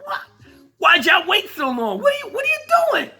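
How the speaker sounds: high, fast shouted disbelief; mock outraged Billy D yelling high and fast; Billy D impression. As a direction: blurt it out as a baffled Billy D. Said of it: "Billy D like".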